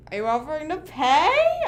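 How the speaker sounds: sassy quote. Retold or imitated. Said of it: "And I'm like".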